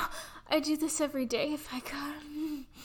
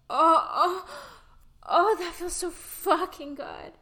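loud moaning; 3 semitones higher, 10 dB louder than usual. Acted. Moaning during intimacy.

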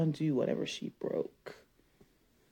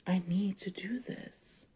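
whisper; 7 dB quieter than usual. Acted. Whispered aside to self.

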